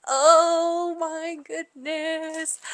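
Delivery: high, drawn-out gush; slow, high-pitched swoon; overcome with emotion. Real, not acted. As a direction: squeal it slowly, swept away by the moment. Overcome by the love confession.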